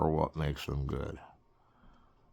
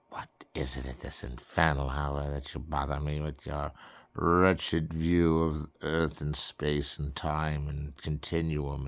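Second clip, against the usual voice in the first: grumpy woken sage. Acted. A woken sleeper scolding the friends who disturbed him. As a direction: grumble it like a cranky oracle.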